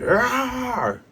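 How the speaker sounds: high drawn-out "arr"; imitated roar. Imitating hypnotized Luffy's roar.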